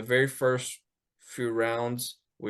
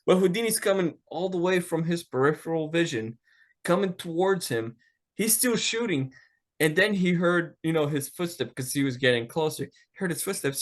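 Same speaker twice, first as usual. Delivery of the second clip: usual speaking voice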